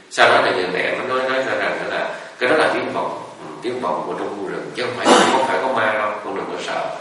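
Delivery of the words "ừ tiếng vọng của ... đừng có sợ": usual speaking voice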